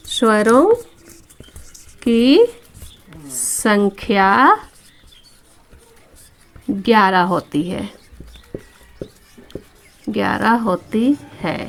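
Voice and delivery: very slow, long pauses between words; word-by-word dictation. Shown as a demonstration. Teacher tells pupils the number of vowels, word-by-word dictation. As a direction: dictate it piece by piece, stretching word endings.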